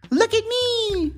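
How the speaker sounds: very high, drawn-out goblin voice. A narrator's reading. Goblin trying on the crown, high show-off voice. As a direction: stretch it out in a high goblin squeal. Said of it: "said the Goblin".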